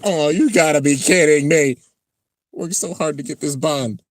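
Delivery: high acted exasperation; exasperated. Character let down after working for bond, acted exasperation. Voicing character seeing his friends.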